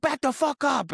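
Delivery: high-pitched barked warning; hammed-up high command; mock threatening yell. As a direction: act out a frantic order to back off. Acted warning at gunpoint.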